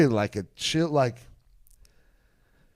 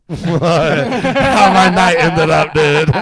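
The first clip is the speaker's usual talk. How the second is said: laughing tease; 5 semitones higher, 10 dB louder, much slower than usual. Retold or imitated. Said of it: "and I was like".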